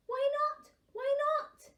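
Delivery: put-on high excited child asking; child's voice. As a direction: squeak it out like an eager little one. Child gruffalo asking dad.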